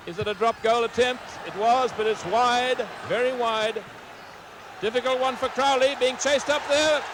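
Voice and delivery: high, tense commentary climbing through the chase; urgent high play-by-play; excited call. Real, not acted. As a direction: ride the rising action. Drop goal attempt and chase.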